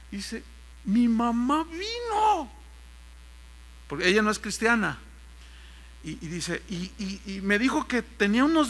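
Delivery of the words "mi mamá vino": high, drawn-out tearful cry; slow high-pitched mock sob; imitated crying. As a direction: wail the joyful news, imitating him. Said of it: "llorando me dice".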